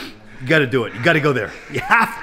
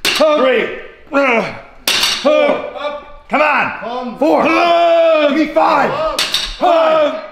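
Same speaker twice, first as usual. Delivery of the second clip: shouting coach; 10 semitones higher, slower than usual. Real, not acted. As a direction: drive them hard with yells. Trainer yelling reps and encouragement.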